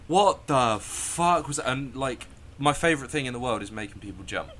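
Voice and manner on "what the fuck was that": loud, high, slow and emphatic; acted indignant outburst after a scare; shocked outrage. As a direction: drag out each swear in retold disbelief. Retells reaction to jump scare.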